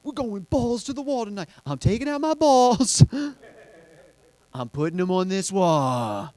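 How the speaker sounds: high-pitched mock bro hype, building to a shout; imitating hyped-up guy friends. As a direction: crow it like a rowdy buddy on a night out. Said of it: "All my friends go".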